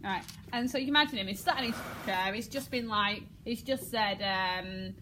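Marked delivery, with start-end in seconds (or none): none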